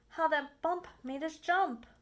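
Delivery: ordinary delivery, nothing marked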